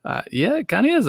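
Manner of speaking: high, caught-off-guard reply; a bit flustered; shy reply to a fan, in a rising voice; sheepish surprise. Retold or imitated. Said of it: "I was like".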